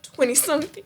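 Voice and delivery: ordinary talk, nothing marked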